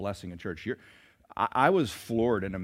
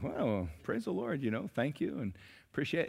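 humble pastor; 3 semitones higher, 7 dB quieter than usual. Retold or imitated. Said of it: "the pastor was like".

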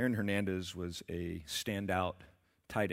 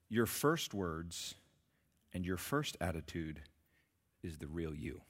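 slow quote; 4 semitones lower, 4 dB quieter than usual. Real, not acted. Quoting C S Lewis slowly.

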